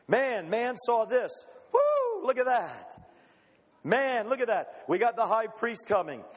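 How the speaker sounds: high, thrilled shouts with a whoop and laugh; excited crowd. Acted. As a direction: cheer like a hopeful crowd. Crowd excited seeing the ark.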